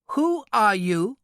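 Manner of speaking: high, slow, precise foreigner; indignant little man, demanding. A narrator's reading. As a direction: demand it slowly, word by word, pitched high.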